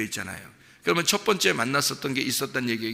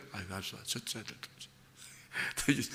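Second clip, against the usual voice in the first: much softer, trailing into a whisper; mock muttered reply; 5 semitones lower, 14 dB quieter, slower than usual. Listener mutters back to the preacher, barely audible. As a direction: mumble it under your breath like a listener.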